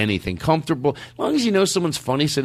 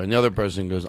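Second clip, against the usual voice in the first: ordinary talk, nothing marked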